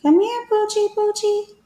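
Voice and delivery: high, sing-song call to a dog; coaxing puppy call. A narrator's reading. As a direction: coax the dog over sweetly. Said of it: "whispered the other".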